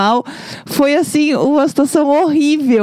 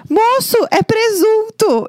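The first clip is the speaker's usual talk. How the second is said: mock alarmed yell; 11 semitones higher, slower than usual. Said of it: "Eu dei um grito".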